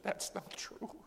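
high, drawn-out tearful protest; slow, strained tearful reply; retold crying. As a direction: squeeze it out through tears. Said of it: "She's crying".

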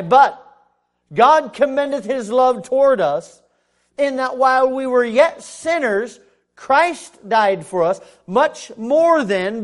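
loud, slow, emphatic scripture reading; full-force preaching. Acted. Preacher declaring Christ died for sinners, full-force preaching. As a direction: drive each phrase home loudly.

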